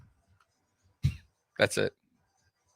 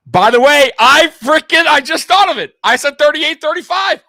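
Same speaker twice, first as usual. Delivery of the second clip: much higher and louder, bursting with excitement; excited shout; 11 dB louder than usual. Real, not acted. Realizing his prediction came true, excited shouting. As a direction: yell it like you just won.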